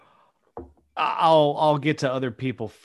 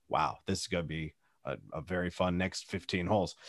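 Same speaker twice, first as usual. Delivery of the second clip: dry sarcastic aside; 7 semitones lower, 10 dB quieter, faster than usual. Retold or imitated. Unspoken sarcastic thought.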